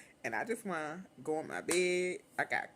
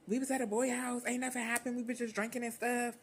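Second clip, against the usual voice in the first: put-on higher, defensive teen girl; god sister voice; 6 semitones higher than usual. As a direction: plead innocent, pitched up. God sister explaining to mother.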